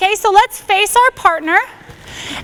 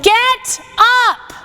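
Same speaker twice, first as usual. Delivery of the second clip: shouting get up; 4 semitones higher than usual. Shown as a demonstration. Instructor on the ground showing the class, shouting. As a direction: yell it to rouse someone. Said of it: "I want you to hear me say to you".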